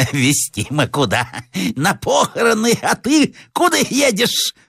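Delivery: high, cackling village madwoman; hoarse madwoman. Acted. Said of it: "сказала охриплым голосом". (полоумная, охриплый высокий голос)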